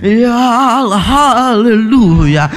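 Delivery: slow, drawn-out haleluya with a quavering voice; trembling high prayer. Retold or imitated. Imitating a former pastor's quavering prayer for a church member, slow and drawn out. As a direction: stretch each word, let it tremble. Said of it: "nadanya bergetar-getar gitu loh".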